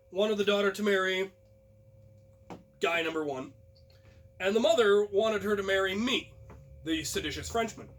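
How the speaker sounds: ordinary delivery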